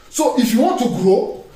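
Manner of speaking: ordinary delivery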